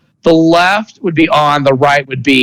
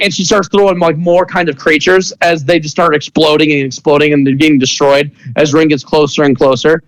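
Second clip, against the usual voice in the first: normal talking voice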